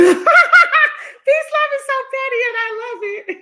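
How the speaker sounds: high, laughing through the words; can't get it out for giggling. Real, not acted. Reading a viewer's petty comment, laughing high.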